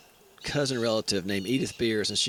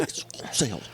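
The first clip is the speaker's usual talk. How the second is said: curt correction; 2 semitones lower, 4 dB quieter than usual. Retold or imitated. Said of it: "he goes".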